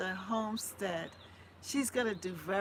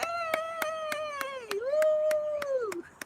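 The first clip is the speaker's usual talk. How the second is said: long high delighted laugh; squealing laughter; joyful high laugh; 3 dB louder than usual. Real, not acted. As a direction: laugh out with joy.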